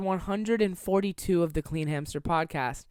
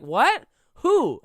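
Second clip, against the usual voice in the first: imitating startled friend; 7 semitones higher, 7 dB louder than usual. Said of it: "he was like".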